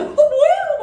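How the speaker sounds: high, loud indignant cry; startled outburst. Retold or imitated. Startled woman snapping at the prankster, high and loud. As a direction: shoot back in shock.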